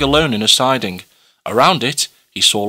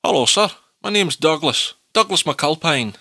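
put-on broad Scottish accent; 4 semitones higher than usual. Scottish works foreman Douglas speaks.

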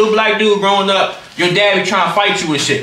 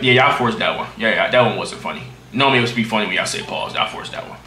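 low voice fading to a soft mumble; unimpressed; 9 semitones lower, 6 dB quieter than usual. Real, not acted. Streamer dismissing chat's forced joke, unimpressed. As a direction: let it sink lower and quieter, deflated.

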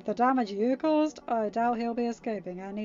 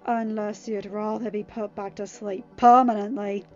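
louder menacing snarl; snarled threat; 9 dB louder than usual. A narrator's reading. Harry threatening the captive with sleep, snarled. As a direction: snarl a warning, understated. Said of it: "Harry snarled".

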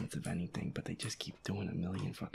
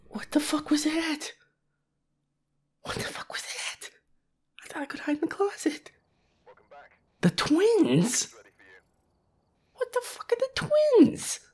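very high, loud panicked screams; high frightened screaming; terrified shriek; 15 dB louder than usual. Real, not acted. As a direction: shriek it in real horror. Shrieking at game horror.

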